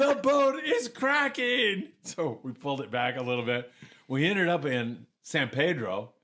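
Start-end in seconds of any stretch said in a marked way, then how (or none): 0.0-1.9 high, loud, drawn-out imitated holler; alarmed yelling. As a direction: yell it slowly from the bow in mock panic. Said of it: "Santella is yelling up in the bow".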